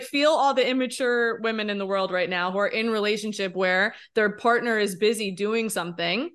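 ordinary delivery

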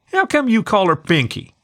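high demanding pup voice; a curious small boy insisting; little boy voice. A narrator's reading. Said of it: "demanded the little husky".

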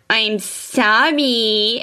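very slow, drawn-out mock apology; super annoying tone. Boy forced to apologize to brother, annoying drawn-out tone. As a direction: stretch it out like an irritating kid. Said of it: "super annoying tone".

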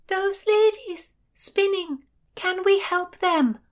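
high, loud, drawn-out shout. A narrator's reading. Heroine calls urgently to the boatman over the water, shouting. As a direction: shout it slowly across the water.

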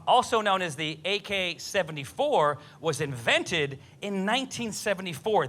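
normal talking voice

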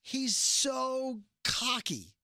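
high, drawn-out fan gushing; emphatic admiration. Real, not acted. Co-host gushing about Negan's swagger, emphatic. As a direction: stretch it out in exasperated admiration.

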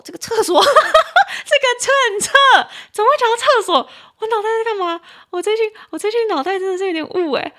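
teacher cracks up after saying toilet instead of policy; laughing at own slip. Real, not acted. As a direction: laugh off the blunder at yourself.

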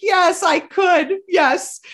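high, slow, half-crying yes; retold sob-choked yes; tearful yes. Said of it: "I'm crying in the weight room".

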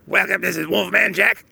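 lightly voiced raspy radio DJ; a hint of the old-school late-night DJ; Wolfman Jack impression. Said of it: "he had the voice like this".

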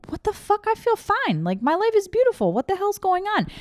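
high-pitched incredulous retort, imitated; baffled protest. Acting out her baffled reply.

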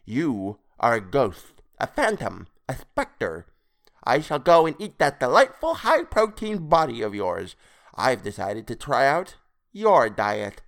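theatrical creature voice, drawing out words; gloating toad creature. A narrator's reading. Creature threatening to eat captive's body, gloating. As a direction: savor the threat as a toad-man.

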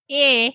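"eh" said as the Kiwi A; Kiwi A sound. Shown as a demonstration. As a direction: say "eh" short and open like a Kiwi A. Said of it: "You also have to pronounce A like".

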